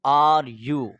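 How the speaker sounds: very slow, each word drawn out; slow teaching demo. Shown as a demonstration. Teacher showing parents how to ask a child, very slow. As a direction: spell it out slowly for a learner. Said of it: "آپ نے بچے سے پوچھنا ہے".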